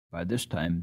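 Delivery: ordinary delivery, nothing marked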